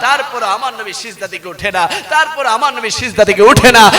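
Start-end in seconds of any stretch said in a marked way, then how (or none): 2.1-3.9 louder, swelling at the end; emphatic repeat. Real, not acted. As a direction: drive the line home with growing force. Repeats line with rising force.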